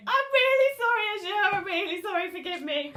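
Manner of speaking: high, pleading teary apology; tearful apology. Acted. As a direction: say it as if crying. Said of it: "with tears in my eyes".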